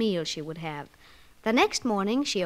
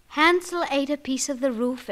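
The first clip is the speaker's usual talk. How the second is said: ordinary delivery, nothing marked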